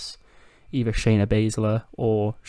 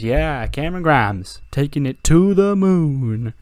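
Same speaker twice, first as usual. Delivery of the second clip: slow, louder and higher victory yell; triumphant yell; 5 semitones higher, 6 dB louder, slower than usual. Retold or imitated. After winning the title, a slow triumphant yell. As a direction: shout the win out slowly. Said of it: "he screams".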